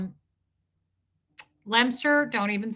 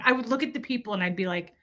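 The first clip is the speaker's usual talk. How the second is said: ordinary talk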